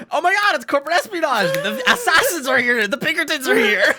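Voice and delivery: very high, loud, fast laughing panic; mock terror. Young shrieks that the assassins are here, mock terror. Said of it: "Five minutes later".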